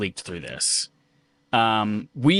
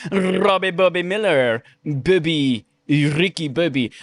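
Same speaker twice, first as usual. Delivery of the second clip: slow, lightly mocking sing-song names; teasing name repeat; 5 semitones higher, slower than usual. Host teasing a chat viewer by lightly mocking his name. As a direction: savor each silly name to tease him. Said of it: "say it a few more times".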